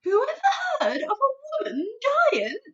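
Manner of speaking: high, loud mocking shout. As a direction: shout it like it's absurd. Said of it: "shouted the B F G".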